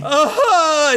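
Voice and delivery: high mock cry of pain; acted high "ah" of pain. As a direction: yelp as if suddenly hurt. [name] falls, screaming.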